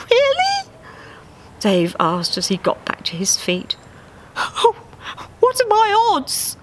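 very high eager duck; Dave's voice. Acted. Dave asking about his odds, very high and eager. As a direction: ask it bright and hopeful.

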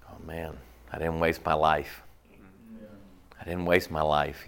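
low, sincere reflection. Retold or imitated. Preacher voicing Moses at life's end, low and sincere. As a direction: let it sink in like a dying man's relief.

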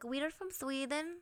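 a clumsy Swedish impression of aliens; hammed-up Swedish accent; bad Swedish accent. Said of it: "that's a terrible Swedish accent".

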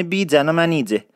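بیژن و منیژه said as bijan and manije with ج; Isfahani j for zh. Shown as a demonstration. Teacher reading Shahnameh names the Isfahani way.

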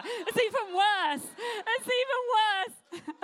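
high, squealing laughter through words; laughing. Real, not acted. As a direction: laugh helplessly as you speak. Laughing at a wrong slide.

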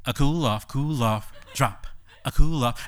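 slow chanted imitation of the song; imitating the lyric. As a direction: drawl the commands slowly, like the track. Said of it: "she's just like".

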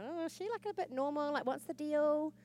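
a lightly voiced puzzled coworker; high, quizzical mock doubt. Mocking how coworkers see her, lightly puzzled. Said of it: "they're just kind of like".

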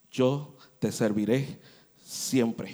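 solemn vow. Acted. Girl vows to serve forever.

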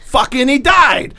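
high, loud, drawn-out mock exclamation; mock outcry. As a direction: cry it out in comic exasperation, stretched and high. Mock outcry echoing the other's joke.

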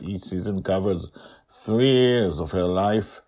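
plain reading voice

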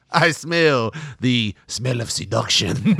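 put-on slow, sultry Spanish-accented purr; Antonio Banderas. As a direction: seduce them in a Spanish accent. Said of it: "he turned into Antonio Banderas there".

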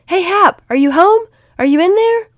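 very high, very loud holler; full shout to rouse someone indoors; top-of-lungs yell. A narrator's reading. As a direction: yell with the volume turned right up. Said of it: "he yelled at the top of his lungs".

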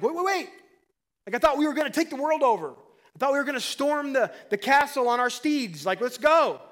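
loud, high, acted-out disbelief; hammed-up excited objection; worked-up protest. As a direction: shout it like an over-eager follower raring for battle. Acts out disciples protesting Jesus leaving.

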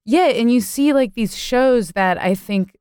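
normal talking voice

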